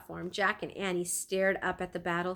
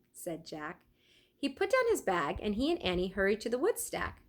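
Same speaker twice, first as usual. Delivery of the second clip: usual speaking voice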